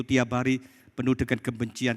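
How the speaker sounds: normal talking voice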